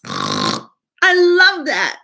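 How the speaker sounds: very slow, savoured delight. Acted. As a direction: linger on it lovingly. Child delighted by Shrek.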